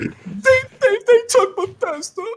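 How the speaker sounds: high, laughing fake sobbing voice; mock crying on the phone, very high; Jack impression crying. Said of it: "let me do my best Jack impression".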